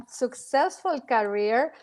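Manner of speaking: ordinary talk, nothing marked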